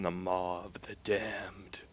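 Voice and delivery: slow, hushed, eerie villain voice; spooky voice. Pretend. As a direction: drag the name out like a haunted relic. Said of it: "I have to say it in the, in the spooky voice".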